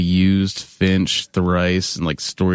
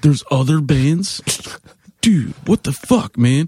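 slow, higher-pitched mock indignation; mock pissed-off; 6 semitones higher than usual. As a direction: imitate a put-out, incredulous fan. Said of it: "was so pissed and stormed off".

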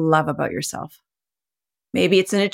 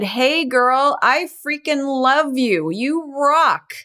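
higher, bright pep-talk to yourself, a touch slow on love; hyped self-cheer; 6 semitones higher, much slower than usual. Pretend. Woman cheering herself on in the mirror, high excited praise. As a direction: cheer yourself on like your own best friend. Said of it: "looked at yourself in the mirror and said".

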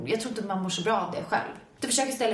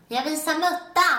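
high, slow mock yell of embarrassment; retold scream; 7 semitones higher, 3 dB louder, slower than usual. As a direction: shout it out, half laughing at yourself. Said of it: "så skriker jag".

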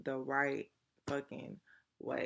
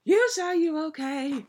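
imitating a drunk friend, higher and louder; drunk friend asking; 10 semitones higher, 7 dB louder than usual. Drunk best friend in the car, nagging the driver, higher mock worry. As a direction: mimic him pestering you over and over. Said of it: "every five minutes he asks me".

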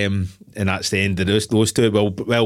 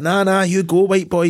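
high-pitched acted movie urging; mock urgent send-off; 10 semitones higher, 4 dB louder than usual. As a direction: wave your mate away, hammed up. Voicing character sending mate away.

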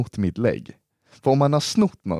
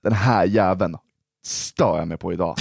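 ordinary talk

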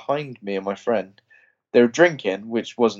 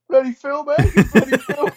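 high, louder mock shout; mock yell at the screen; 13 semitones higher, 6 dB louder than usual. Heckling Phil in the cinema, louder high mock shout. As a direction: yell it at the screen, half joking. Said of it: "I'm looking around at everyone when I say that".

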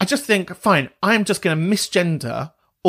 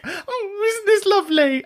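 teary. Real, not acted. Touched to tears by listeners.

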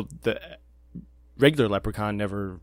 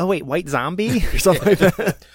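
guessing waitress; 8 semitones higher, 8 dB louder than usual. Retold or imitated. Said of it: "riffing on same guess".

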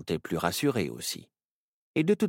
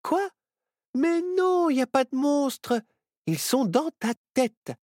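Myrtille dismissive; 5 dB louder than usual. Acted.

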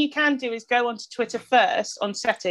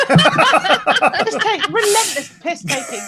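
laughing; 8 semitones higher, 6 dB louder, much slower than usual. Real, not acted. Laughing while describing road trip.

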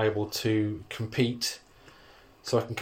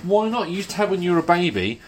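higher, louder, faster insisting mum; 6 semitones higher, 4 dB louder, faster than usual. Retold or imitated. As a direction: push back like a nagging mum. Mum voice in retold argument.

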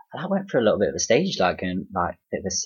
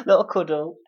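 higher, laughing Derby accent; playful Derby accent; 9 semitones higher, 3 dB louder than usual. Shown as a demonstration. Guest echoing the teasing host, playful high Derby accent. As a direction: play up your Derby accent through a laugh. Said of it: "I love the way you say that as well".

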